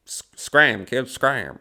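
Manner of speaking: put-on grumpy scram, kid; old movie brush-off. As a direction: shoo the kid away, fifties gangster style. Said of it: "it's a nineteen fifties movie".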